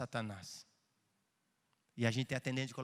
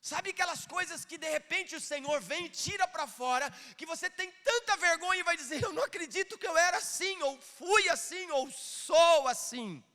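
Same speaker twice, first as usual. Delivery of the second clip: higher, louder preaching with shouted peaks; fiery pulpit preaching, voice raised and cracking out loud; preaching at full force; 7 dB louder than usual. Acted. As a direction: preach it hard, building to shouts.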